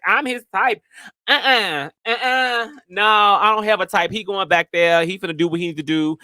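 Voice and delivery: high, flustered denial; mock denial. Teasing herself to her friend, high flustered mock denial. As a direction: deny it all in a high fluster. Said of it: "And I was like".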